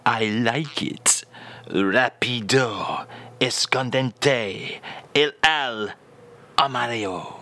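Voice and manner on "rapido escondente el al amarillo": slow phrase repeat. Shown as a demonstration. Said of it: "And once more, [name]".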